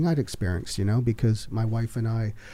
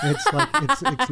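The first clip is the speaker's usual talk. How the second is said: laughing. Real, not acted. Laughing about showbiz.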